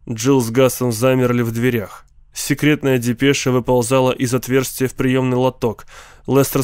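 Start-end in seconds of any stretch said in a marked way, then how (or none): none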